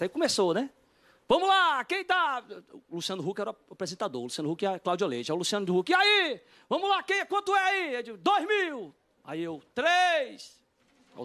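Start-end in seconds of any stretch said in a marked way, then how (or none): 1.3-2.4 high, loud mock auctioneer; imitated host shouting to the room; auction host call. Imitates auction host calling for bids. 5.9-7.9 imitated host shouting to the room; auction host call. Imitates auction host calling for bids. 8.2-8.9 high-pitched mock bidding call; acted auction yell; retold bid shout. 9.7-10.6 high-pitched mock bidding call; acted auction yell; retold bid shout. Calling out his own bid.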